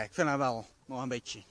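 ordinary talk

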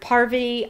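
"Parvati" as PAR-vuh-tee; first-syllable emphasis. Shown as a demonstration. Reader lists versions heard, "Parvati" as PAR-vuh-tee. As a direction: stress the first syllable of "Parvati", weak middle vowel. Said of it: "which part to emphasize".

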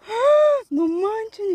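high, loud gasp of disbelief; shocked exclamation. Real, not acted. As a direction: blurt it out, startled by the distance. Startled by how far down they are.

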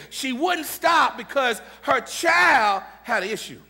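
loud, high, shouting sermon climax; full-force preaching. Acted.

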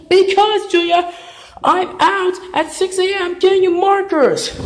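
high, loud frustrated retort; fed up after getting up early; exasperated yell. Acted. Exasperated adult shouting back.

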